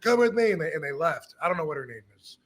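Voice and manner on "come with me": firm command. Retold or imitated. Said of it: "he grabbed her and said".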